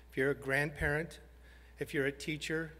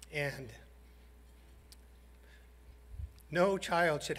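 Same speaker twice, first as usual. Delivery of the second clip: holding back tears mid-story; choked up. Real, not acted. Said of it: "I'm sorry if I get emotional".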